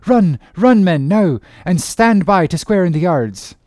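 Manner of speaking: beg, then seize on hope; frantic pleading captain. Acted. Stranger captain pleading, then hopeful.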